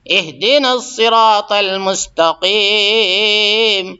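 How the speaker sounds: slow, drawn-out chanted recitation; Quran recitation. Shown as a demonstration. Teacher reciting Fatiha verse for students, slow chant. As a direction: recite each word slowly and clearly.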